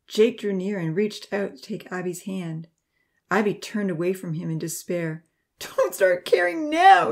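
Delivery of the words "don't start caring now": high, loud, anguished reproach; wounded outburst pitched well above the narration; bitter sobbing. A narrator's reading. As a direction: drag out the words in bitter, tearful hurt. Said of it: "she sobbed bitterly".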